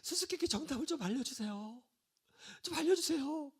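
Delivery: soft, high, mock-weepy begging; acted whimpering entreaty; tearful pleading. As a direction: plead as if crying, small high voice. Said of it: "울면서 사정하는 거예요".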